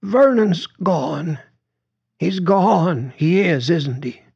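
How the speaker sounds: high, slow, tearful plaint; grieving widow. A narrator's reading. Widow grieving, voiced in story.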